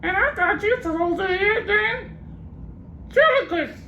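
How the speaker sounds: very high parrot, gloating squawk at the end; cheeky parrot. Acted. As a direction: win the argument as the parrot.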